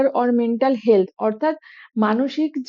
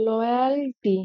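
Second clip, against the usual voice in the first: Loyalty repeated softer, syllables stretched apart; drawn-out repetition; 4 dB quieter, much slower than usual. Shown as a demonstration. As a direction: say loyalty slowly and evenly for learners. Repeats word slowly for learners.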